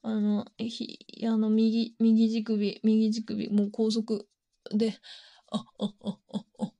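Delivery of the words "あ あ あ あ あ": soft, broken moans into a whisper; real moans. Real, not acted. Streamer touching herself on stream, hushed moans. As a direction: moan quietly, barely voiced.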